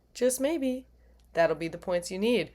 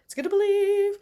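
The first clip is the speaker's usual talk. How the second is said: high cheer; 11 semitones higher than usual. Shown as a demonstration. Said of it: "believe in yourself".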